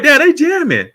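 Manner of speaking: loud, high delighted exclaim; imitating himself impressed by the band; excited. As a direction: hype it up like the band's jamming. Retelling reaction after cigar.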